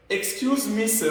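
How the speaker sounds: normal talking voice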